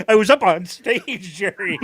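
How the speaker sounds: high, loud, hammed-up sitcom outburst; Kramer. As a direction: burst out jittery, then drop on the name. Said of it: "this as Kramer".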